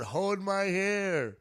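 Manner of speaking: put-on high, slow girly voice; coy party girl asking sweetly.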